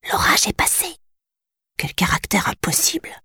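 soft unvoiced whisper to a friend. A narrator's reading. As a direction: breathe it secretly to Soumada. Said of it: "chuchota Ariane".

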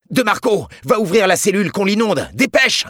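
loud, high-pitched urgent shout; bellowed order. A narrator's reading. Team leader sends the patrol guard to open the burning cell, bellowing. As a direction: shout the command down the corridor. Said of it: "Celui qui venait de gueuler".

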